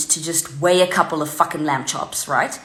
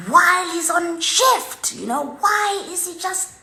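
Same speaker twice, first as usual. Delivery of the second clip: higher, outraged rant at staff; retold shout; 10 semitones higher than usual. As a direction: let rip at the shop floor. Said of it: "I shout".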